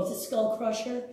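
ordinary delivery

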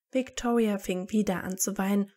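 ordinary delivery

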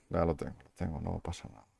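soft, low self-reassurance; calming down quietly. Real, not acted. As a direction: settle yourself under your breath. Calms himself after panic. (tranquilizándose en voz baja)

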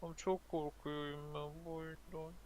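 soft, slow scared voice; quiet scared. Real, not acted. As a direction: murmur it nervously. Scared while playing.

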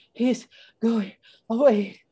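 high, breaking the words with panting breaths. A narrator's reading. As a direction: gasp it word by word after running. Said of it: "Annie was panting".

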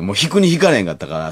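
normal talking voice

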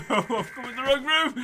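laughing through the panic; panicked laughter. Real, not acted. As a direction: shout it while laughing.

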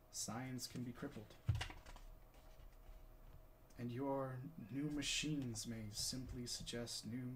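subdued stage monologue, sinking at the ends; Galileo, grave. Acted. Galileo warning that science can be crippled, grave and restrained.

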